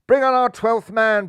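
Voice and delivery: a crowd-pleasing boom; booming call. A narrator's reading. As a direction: boom it across the field. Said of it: "boomed Buntham".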